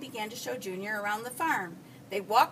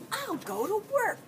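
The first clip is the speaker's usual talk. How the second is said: put-on high-pitched resolute boy; declaring; 9 semitones higher than usual. A narrator's reading. Junior decides on a plan for money, declaring it high. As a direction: announce the decision, pitched well up. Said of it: "declared Junior".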